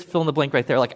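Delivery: normal talking voice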